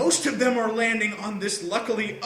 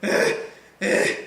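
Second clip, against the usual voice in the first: dazed half-groan, louder; stunned groan; 4 dB louder than usual. Retold or imitated. Still dazed, walking back into the classroom. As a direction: groan like you are still in shock. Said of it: "still going".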